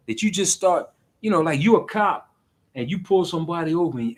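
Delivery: normal talking voice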